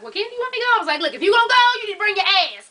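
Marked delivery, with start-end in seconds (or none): 0.0-0.7 high-pitched quoted question; meek offer imitated. Housemate offering to come along, high-voiced imitation. 1.0-2.7 high, loud retold scolding; impatient order. Late driver telling housemate to come, impatient. Her irritated answer to Robbie.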